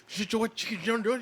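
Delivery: put-on high, laughing intense Irish accent; fired-up Irish brogue, cracking up; Conor McGregor impression. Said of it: "Talking like Conor McGregor and shit".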